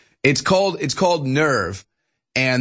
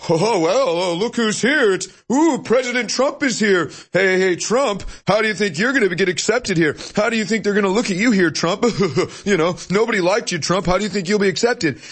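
higher sneering taunt; mocking media voice; 6 semitones higher than usual. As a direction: taunt Trump like a snide reporter. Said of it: "the same fake media is like".

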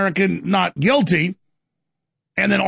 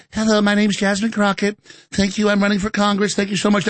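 Jasmine Crockett. Acted.

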